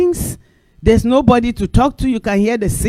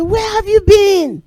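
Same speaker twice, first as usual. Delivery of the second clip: high, slow, curious question; imitating neighbour; 12 semitones higher, 4 dB louder than usual. Neighbour asking the preacher where she has been, high and slow. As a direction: ask like a friendly neighbour.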